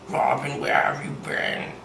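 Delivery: whispered villain menace, amateur impression; a weak, whispered take on a supervillain; bad Slade voice. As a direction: hiss it like a cartoon villain lurking. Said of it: "I can't do a good Slade impersonation".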